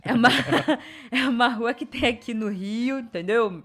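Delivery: badly faked Rio de Janeiro accent; a clumsy attempt at a carioca accent. Shown as a demonstration. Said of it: "eu tentei fazer sotaque carioca agora e ficou uma merda". (sotaque carioca forçado)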